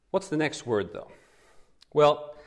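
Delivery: ordinary delivery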